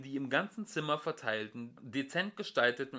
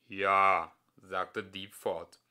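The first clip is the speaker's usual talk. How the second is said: lower, deliberate machine voice; lower, calm computer; ponderous computer; 2 semitones lower, 7 dB louder than usual. A narrator's reading. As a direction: weigh every word like a vast computer. Computer voice held.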